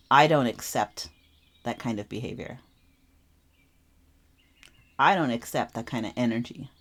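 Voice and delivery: low, calm, steady and firm. Retold or imitated. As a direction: set a boundary calmly, looking them in the eye. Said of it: "very calmly, eye contact".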